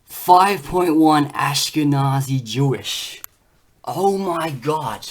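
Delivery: slow, loud, drawn-out amazement; real shock at the result; stunned reveal. Real, not acted. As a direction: read it out like you can't believe it. Reading shocking DNA result aloud.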